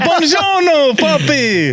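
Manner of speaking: high, hammed-up fake Italian accent. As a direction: ham up a mock Italian accent. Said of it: "a fake Italian accent".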